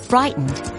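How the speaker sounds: ordinary delivery, nothing marked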